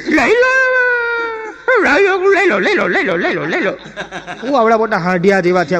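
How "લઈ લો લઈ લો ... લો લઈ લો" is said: high nagging dog voice; mother dog scolding. Retold or imitated. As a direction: scold the father dog mockingly. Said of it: "કૂતરાને ખખડાઈને કે". (કૂતરીનો ખખડાટ)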